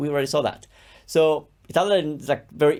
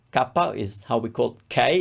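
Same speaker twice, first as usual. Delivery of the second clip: normal talking voice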